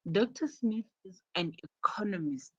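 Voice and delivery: "economist" set apart, stressed on "con"; emphasize "economist" as the new information. Shown as a demonstration. Teacher models new information, stress on "economist". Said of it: "you emphasize the word economist".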